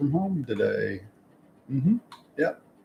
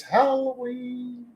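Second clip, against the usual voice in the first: excited sing-song; much slower than usual. Real, not acted. Announcing the holiday.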